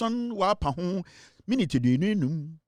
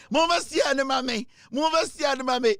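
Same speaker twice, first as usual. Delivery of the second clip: acted shout; 7 semitones higher than usual. Same shouted command repeated.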